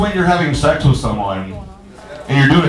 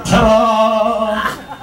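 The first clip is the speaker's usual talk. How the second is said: sing-song ta-da; 8 semitones higher than usual. Acted. Magician reveal after vanishing.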